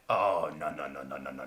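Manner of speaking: low, hushed run of no's; retold panic. Tourist panicking in the tunnel, muttered low. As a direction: mutter it fast under the breath.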